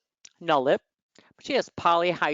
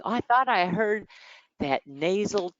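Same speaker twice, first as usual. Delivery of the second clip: quoting the woman; 6 semitones higher than usual. Retold or imitated. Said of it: "she's like".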